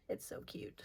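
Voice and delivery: breathy whispered aside; whispering. Real, not acted. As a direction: whisper it to yourself fondly. Whispered aside about a doodle.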